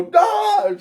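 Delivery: high, loud name called out; shouted call. Retold or imitated. Calling coworker again, loud shout. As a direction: holler her name. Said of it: "I'm gonna scream it out loud".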